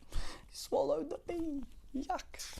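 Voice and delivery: high, squeamish little voice; mock disgust. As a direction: recoil at swallowing a bug. Disgusted reaction to swallowed bee.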